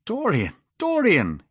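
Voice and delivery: high, loud pleading call; cried out. A narrator's reading. Painter cries out to stop the boy, high and loud. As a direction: call to him in alarm. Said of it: "he cried".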